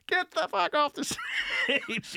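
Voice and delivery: imitating screaming teen girls; teen girls heckling. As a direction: jeer like a bratty young fan. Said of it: "twelve and thirteen-year-old girls".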